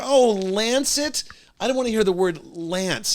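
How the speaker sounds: high, drawn-out alarmed "Oh, lance it?"; alarmed surprise. Retold or imitated. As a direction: recoil at the idea, slow and loud. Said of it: "I go".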